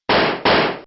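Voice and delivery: a breathy, menacing killer's laugh; gloating cackle. Acted. Killer gloats after shooting the mayor, menacing laugh. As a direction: laugh low over your victim.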